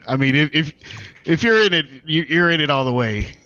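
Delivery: loud, high, laughing insistence; excited outburst. Real, not acted. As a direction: push it up high and loud, all in. Player jokes loudly at table.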